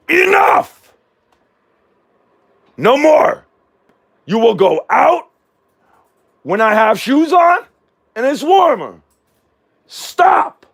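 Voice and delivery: loud high shout at the dog. Real, not acted. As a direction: scold the dog, full volume. Yelling at barking dog.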